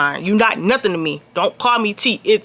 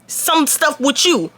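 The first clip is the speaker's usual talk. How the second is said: annoyed confrontation; 7 semitones higher than usual. Retold or imitated. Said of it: "I go to Haley and was like".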